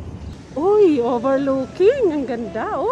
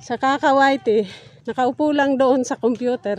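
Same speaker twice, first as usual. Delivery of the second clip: ordinary talk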